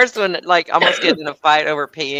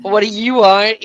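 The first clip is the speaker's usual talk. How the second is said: louder, slower "What do you want?" with the stress on "want", a touch of defiance; challenging demo; 5 semitones higher, 7 dB louder than usual. Shown as a demonstration. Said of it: "you know, like this".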